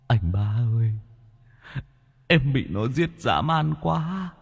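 high, eerie ghost voice of a murdered brother; ghost pleading. Acted. Dead brother's ghost warns Bá in a dream, eerie high plea. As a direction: wail it out like a drowned ghost begging.

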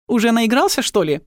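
Tilly voice. Acted.

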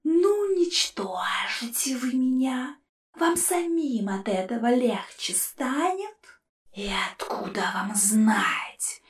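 soft, slow spectral sneer; whispered ghostly scorn; mocking ghost. A narrator's reading. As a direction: taunt them from inside the jar. Ghost skull voice.

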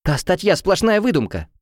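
high indignant protest; indignant cry. A narrator's reading. Heroine to the attacker, indignant cry. Heroine yelling during the scuffle.

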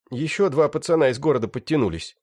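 high-pitched rough bandit; young thug voice. Acted. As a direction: whine it like a wounded gangster. Раненый бандит. (высокий голос молодого бандита)

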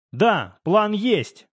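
ordinary delivery

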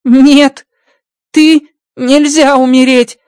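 high, slowed alien voice, distressed; Rocky distressed at his friend's coming death; trembling voice. Acted. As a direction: alien friend refusing to lose him. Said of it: "дрожащим голосом говорит Рокки".

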